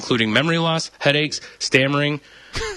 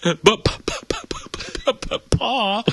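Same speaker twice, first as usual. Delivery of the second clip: mock stammering son; 8 semitones higher than usual. Playing a stammering hillbilly son.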